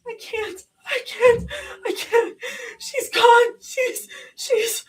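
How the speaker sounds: high crying stammer; breaking tearful repeats; crying, tripping words. A narrator's reading. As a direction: stumble through the sobs. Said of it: "words tripping over each other".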